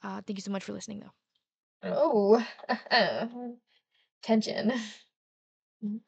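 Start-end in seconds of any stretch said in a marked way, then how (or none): 2.5-3.5 short real laugh at her own slip. Real, not acted. Reader laughing at her own flub, real laugh. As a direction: burst out laughing at yourself.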